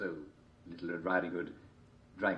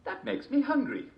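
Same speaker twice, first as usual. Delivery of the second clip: put-on girl voice; 3 dB louder than usual. A narrator's reading.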